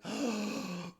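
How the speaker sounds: sharp mock gasp of delight. Reacting to the gun shop owner, a delighted gasp. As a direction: gasp in pleased surprise.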